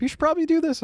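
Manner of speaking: high, motherly suggestion; mom's nudging voice. Retold or imitated. Mom voiced in story.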